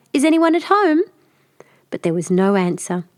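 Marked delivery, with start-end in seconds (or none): 0.1-1.0 a raised, higher call through the door; hopeful little call at a stranger's door. A narrator's reading. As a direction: call out to see if anyone's in. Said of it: "tapped at the door and cried".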